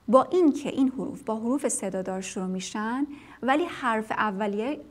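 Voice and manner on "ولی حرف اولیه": ordinary talk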